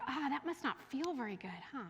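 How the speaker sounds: put-on commiserate softly with a hurt child; tender it's-okay tone; gentle sympathy. Shown as a demonstration. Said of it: "that's a support role".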